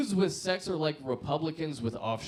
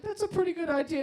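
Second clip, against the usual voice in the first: eager agreement; 3 dB louder, faster than usual. Retold or imitated. Mock-eager reply to the friend.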